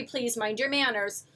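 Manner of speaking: ordinary delivery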